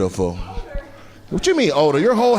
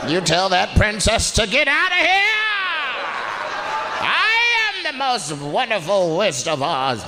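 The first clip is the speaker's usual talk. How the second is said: 1 mock witch; 6 semitones higher than usual. Comic voicing the lady as witch.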